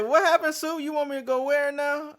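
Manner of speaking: high, loud, confused question; baffled, asking him to repeat; incredulous. Retold or imitated. To the supervisor, asking again in disbelief. Retold confused reply to boss.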